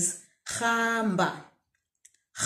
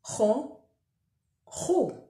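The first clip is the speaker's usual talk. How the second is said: slower; 4 semitones higher, slower than usual. Shown as a demonstration. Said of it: "Slower this time".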